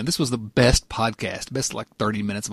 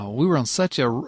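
usual speaking voice

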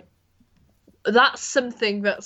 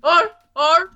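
high, loud sea lion bark again; sea lion impression; 7 semitones higher, 7 dB louder than usual. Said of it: "Do it again".

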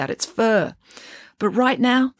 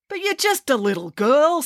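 high incredulous farmer voice; 10 semitones higher than usual. Acted. Farmer doubting.

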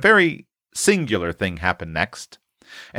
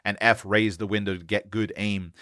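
ordinary delivery

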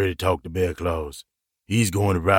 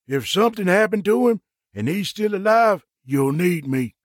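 higher-pitched insistent plea; 8 semitones higher than usual. Acted. Meta arguing to ride along, higher-voiced insistence. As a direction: press the point, starting high and settling down. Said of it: "Meta insisted".

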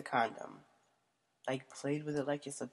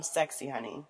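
ordinary talk, nothing marked

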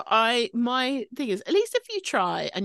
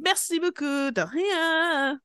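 mock French accent; 9 semitones higher, slower than usual. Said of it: "I was like".

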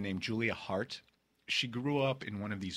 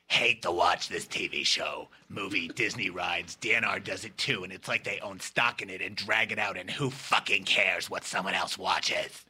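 Harvey Fierstein; 3 semitones lower than usual. Retold or imitated. Host reads listener's all-caps email to the room, low character voice. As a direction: read the email as a low, emphatic character rant. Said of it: "she kinda has that voice".

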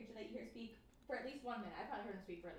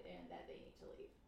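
cult leader voice; 5 dB quieter than usual. Pretend. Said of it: "I wanna mimic his voice".

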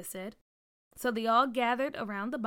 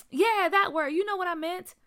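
high, loud comeback; defensive retort; 8 semitones higher, 6 dB louder than usual. A narrator's reading. Byron corrected by Peach, defensive retort. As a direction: brush off the correction loudly. Corrected child snaps back.

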